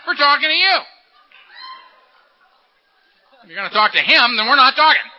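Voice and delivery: loud, high, indignant shout; beast yelling. Acted. The angry beast demanding to be talked to, yelling. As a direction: yell like a jilted tempter demanding attention. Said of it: "He's yelling at me".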